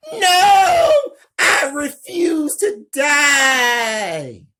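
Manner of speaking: a desperate dying scream of refusal; yelling. Acted. Dying vampire screams his refusal, desperate shout. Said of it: "Sting yells".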